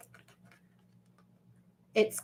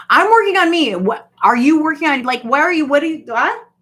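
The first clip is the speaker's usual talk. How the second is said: high, loud mock challenge; acted indignant retort; 6 semitones higher, 10 dB louder than usual. Answering society's judgment of her, loud acted indignation. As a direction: fire questions back, high and loud.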